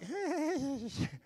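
high airy nervous laugh, fading. Real, not acted. Preacher laughing at his awkward wait in the café. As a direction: let a nervous laugh slip out. Tense waiting in empty Starbucks.

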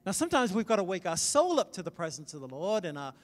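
ordinary talk

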